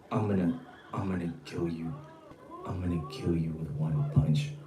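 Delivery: very low, hushed mock threat; mocking his talk. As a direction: mimic his quiet, deep menace. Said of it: "So he talks like this".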